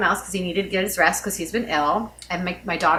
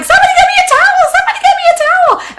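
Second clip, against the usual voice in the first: fast, high screamed plea; loud panicked shriek, re-enacted; frantic yelling; 11 dB louder, faster than usual. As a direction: yell across the house for help. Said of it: "I'm, like, yelling".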